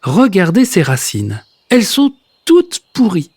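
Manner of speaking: exclaiming. Acted. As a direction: exclaim over the rotten roots. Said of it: "le voisin d'en bas qui s'exclame".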